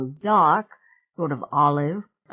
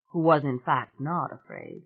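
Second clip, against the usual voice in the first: normal talking voice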